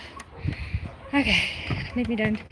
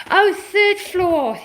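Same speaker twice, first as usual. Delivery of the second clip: Oh drawn out higher, slow; slow dawning realization; 6 semitones higher, 3 dB louder, much slower than usual. Shown as a demonstration. Vlogger realizing where the router went, slow surprise. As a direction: let it sink in, stretching "Oh". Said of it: "Oh, third floor (first take)".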